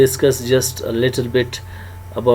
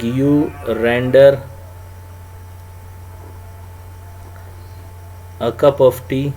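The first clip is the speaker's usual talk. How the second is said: slow dictation; 5 dB quieter, slower than usual. Shown as a demonstration. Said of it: "Me will be followed by a preposition".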